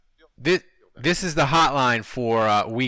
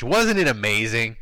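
normal talking voice

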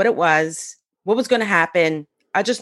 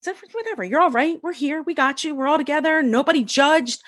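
lightly voiced women reassuring; 7 semitones higher than usual. The circle women soothing her, gently mock reassuring. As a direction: comfort a crying stranger, lightly. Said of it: "And they're like".

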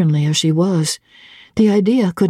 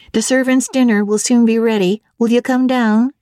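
a higher cook's voice; lightly voiced cook, brisk and higher; the cook calling Grace Poole down to dinner, higher; 5 semitones higher than usual. Cook speaking.

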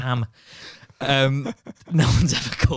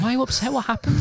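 much higher, laughing mock mum; concerned mum, hammed up high; laughing imitation of a worried mum; 13 semitones higher, 4 dB louder, faster than usual. Mum voiced in story.

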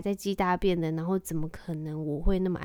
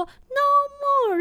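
put-on child's voice; 8 dB louder than usual. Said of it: "然后亨特就说".

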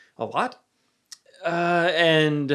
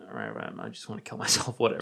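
much lower and softer, a flat resigned mumble with a laugh; deadpan resignation; 9 dB quieter, faster than usual. Retold or imitated. Going along with the friend's plan, in a low, resigned, deadpan mumble. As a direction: mumble it low like you've given up.

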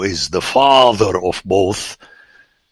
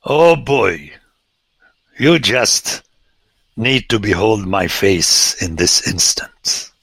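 usual speaking voice

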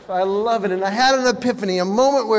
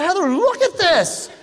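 high, drawn-out exclamation; urgent mock call for attention; alarmed call to wife; 9 semitones higher than usual. Calling wife to see hair.

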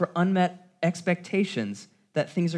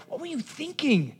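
high, mock-whiny griping; a high sarcastic impression of someone disappointed; frustrated complaint; 10 semitones higher than usual. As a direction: whine it like a let-down friend.

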